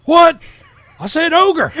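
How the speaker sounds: high, loud mock panic cry; over-the-top panicked yell; hammed-up yelling. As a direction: yell the alarm like a panicked teacher. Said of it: "he yelled".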